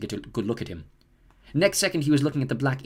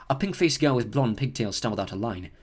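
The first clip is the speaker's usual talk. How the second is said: plain reading voice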